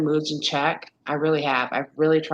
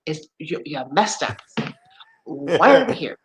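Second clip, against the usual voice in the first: stern scolding; 3 semitones higher, 5 dB louder than usual. Retold or imitated. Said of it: "I'm like".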